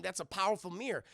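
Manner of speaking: ordinary delivery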